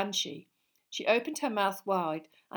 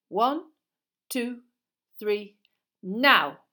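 measured count ending in a loud "now"; deliberate count, sudden loud go; countdown to a command; 3 semitones higher, 9 dB louder, much slower than usual. A narrator's reading. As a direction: count out with pauses, then call out. Teacher counts down then signals.

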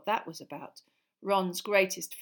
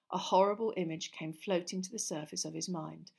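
usual speaking voice

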